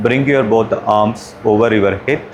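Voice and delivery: normal talking voice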